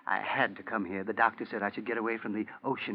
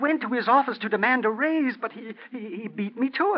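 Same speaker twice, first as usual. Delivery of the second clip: nervous high excuse; 10 semitones higher, 6 dB louder than usual. Acted. Husband's high anxious excuse.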